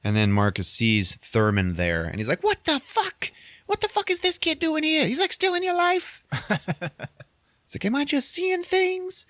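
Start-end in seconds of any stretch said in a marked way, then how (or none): 2.4-6.2 very high-pitched mock outrage; incredulous outburst. Marcus spotting the kid there, incredulous and high-pitched. 7.8-9.2 high, drawn-out mock disbelief. Man seeing the kid still there, incredulous. Marcus disbelieves what he sees.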